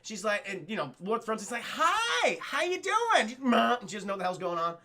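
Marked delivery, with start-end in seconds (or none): 1.6-3.3 high, singled-out cheery imitation; mock friendly greeting. Scientist greeting confused Alice, high mock greeting. Greeting the dazed patient, retold. 3.4-3.8 loud imitated senseless noise; mock dazed groan. Alice released from tube, mock confused grunt.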